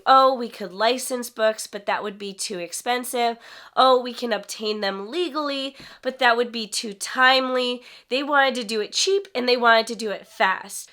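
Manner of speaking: ordinary talk, nothing marked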